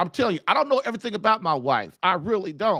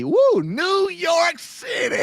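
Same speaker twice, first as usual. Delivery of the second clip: excited whoop; 9 semitones higher, much slower than usual. Real, not acted. Greeting viewer from New York.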